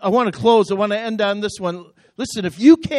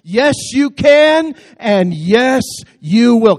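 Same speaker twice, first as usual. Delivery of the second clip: high, loud, slowed preaching shout; rousing shout; 4 semitones higher, 8 dB louder, slower than usual. Real, not acted. Preacher shouting the refrain to fire up the church. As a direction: belt the refrain out to lift the room.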